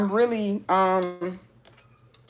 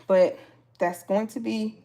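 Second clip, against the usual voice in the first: ordinary delivery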